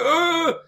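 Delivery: sharp high cry of pain; pained yelp. Retold or imitated. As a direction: yelp like a tooth cracking. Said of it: "I was like".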